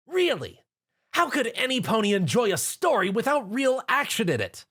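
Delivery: high, scornful retort; barking back. A narrator's reading. Rainbow scoffs at Rarity's detective novels, high and scornful. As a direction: throw it back at her with scorn. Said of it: "Rainbow barked back".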